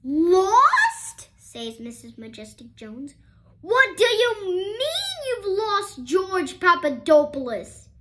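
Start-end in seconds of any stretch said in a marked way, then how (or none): 0.0-1.3 high, loud disbelieving cry; shocked teacher demanding; outraged disbelief. A narrator's reading. 3.6-7.9 high, loud indignant demand; angry teacher. A narrator's reading.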